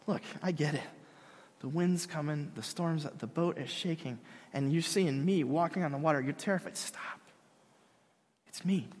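soft, quiet calming talk; soothing hush. Retold or imitated. Jesus calming a terrified Peter, quietly. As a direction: settle a frightened friend.

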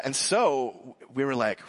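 usual speaking voice

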